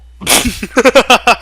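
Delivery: loud high villain laughter; hammed-up maniacal laugh; evil cackle. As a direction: keep cackling like a madman.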